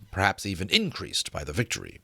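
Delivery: ordinary delivery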